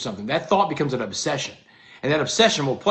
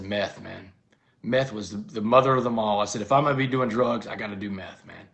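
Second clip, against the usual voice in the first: quiet confession; 7 semitones lower, 5 dB quieter than usual. Retold or imitated. Confessing meth to his sponsor.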